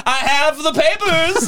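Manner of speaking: put-on old man flashing the dog papers in the kitchen; proud old man. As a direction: hold the papers up like an FBI agent. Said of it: "He'd be like".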